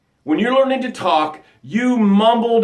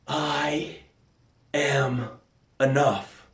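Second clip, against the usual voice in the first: softer, slow and lower; hushed mantra; 4 semitones lower, 8 dB quieter, much slower than usual. Shown as a demonstration. Coach reviving the drowning listener, hushed slow mantra. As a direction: breathe the words out like a discovery. After the breath, mantra revealed.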